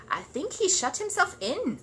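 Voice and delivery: musing slowly; gentle old man voice. Acted. Mr Gruber, slow.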